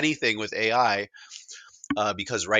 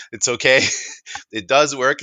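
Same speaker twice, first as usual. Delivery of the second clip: higher, louder, through a chuckle; laughing reassurance; 4 semitones higher, 6 dB louder than usual. Real, not acted. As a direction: reassure them while chuckling. Chuckles while reassuring the doubters.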